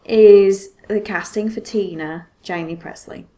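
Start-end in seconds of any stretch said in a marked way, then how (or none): none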